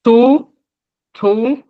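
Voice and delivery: To said high and loud; tone demo. Shown as a demonstration. Teacher models the noun To. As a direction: punch out To with a high tone. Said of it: "le nom".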